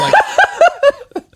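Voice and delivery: high loud laughter; cracking up; laughing. Real, not acted.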